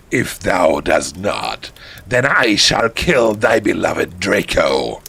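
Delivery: slow, low mock-menacing voice; drawn-out archaic villain; villain threat. Voldemort threatening the heroine's beloved, slow menace.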